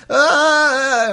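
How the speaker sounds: sudden high wail, hammed up; mock cry of anguish. Narrator mimics Jacob's wail after kissing Rachel, hammed-up high yelp. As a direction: cry out like a lovesick man, overdone. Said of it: "then goes".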